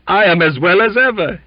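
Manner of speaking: high, loud, gasping after coughing; breathless insistence; out of breath. Acted. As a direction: force it out after a coughing fit. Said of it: "as soon as he could draw a breath".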